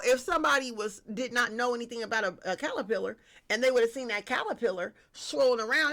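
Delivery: ordinary delivery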